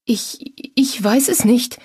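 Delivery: high, frightened stammer; whimpering. A narrator's reading. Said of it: "sie wimmerte wieder".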